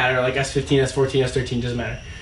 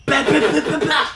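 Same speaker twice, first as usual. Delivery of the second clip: engine noise imitation; 11 semitones higher than usual.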